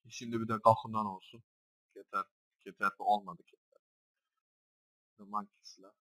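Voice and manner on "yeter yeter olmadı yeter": ordinary talk, nothing marked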